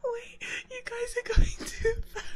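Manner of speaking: ordinary delivery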